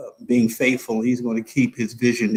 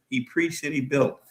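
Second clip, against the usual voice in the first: quiet echo; 8 dB quieter than usual. Real, not acted. Preacher repeats line.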